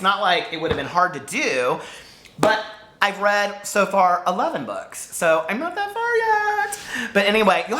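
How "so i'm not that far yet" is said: drawn-out sing-song. Real, not acted. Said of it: "drawn-out high sing-song".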